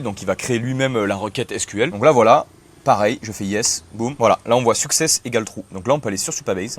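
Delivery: usual speaking voice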